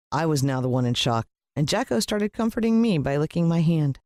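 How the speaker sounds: usual speaking voice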